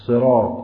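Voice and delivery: صراط said with a sharp whistling ص; whistled s. Shown as a demonstration. Teacher mimicking how clerics whistle صراط, sharp and loud.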